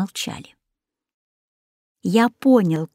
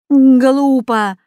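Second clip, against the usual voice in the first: louder, higher scoffing word; the dog dismisses the plan with a loud scornful snort; 5 semitones higher, 7 dB louder than usual. Acted. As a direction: snort it out with disdain. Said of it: "фыркнул Рекс".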